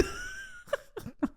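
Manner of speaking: very high, thin laugh-cry; tearful laugh. Real, not acted. Exhausted host breaking down to her co-host, tearful laughing. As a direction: dissolve into a soft high squeal of laughter and tears.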